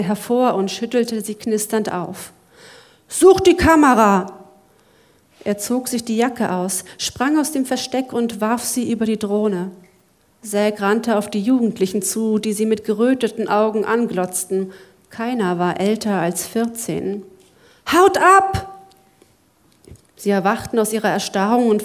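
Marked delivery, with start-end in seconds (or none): 3.1-4.5 higher, louder barked order; urgent command. Acted. 17.9-18.8 much higher, louder yell; shouting. Acted. Zack chases off teenagers.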